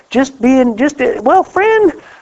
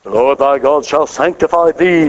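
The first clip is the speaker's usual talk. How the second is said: lower, solemn churchy intoning; pompous mock sanctimony; mock religious language; 8 semitones lower than usual. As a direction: parody stiff King James preaching. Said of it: "don't use religious language".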